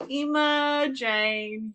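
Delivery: loud, high, drawn-out name; excited cheer. Real, not acted. Host celebrating the giveaway winner, excited drawn-out cheer.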